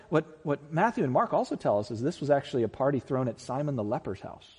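ordinary delivery

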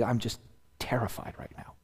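hushed whisper; whispered aside. Real, not acted. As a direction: drop to a whisper. Confessing fear, hushed.

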